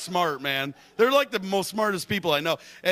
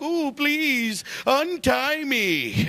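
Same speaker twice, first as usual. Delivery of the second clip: high, slow pleading whine; mock a tied-up friend begging in a higher whine; whiny captive; 8 semitones higher, much slower than usual. Tied-up buddy begging the host to untie him, high slow whine. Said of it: "They're like".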